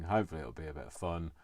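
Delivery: usual speaking voice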